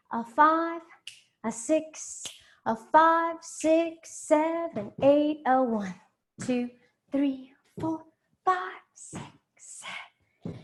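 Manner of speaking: counting the steps out in sung rhythm, rising; rhythmic dance count. Acted. Dance teacher counting the class through the steps in rhythm. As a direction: call the beats to the class.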